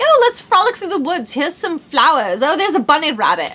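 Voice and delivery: high, sing-song mock girly voice; mock frolicking girly tone. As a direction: parody dainty girl-product talk. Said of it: "the girl stuff is all like".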